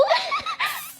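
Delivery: high, screechy shocked laugh; shrieking laugh. Acted. Shriek at foot-kissing demand. (tawa menjerit yang melengking)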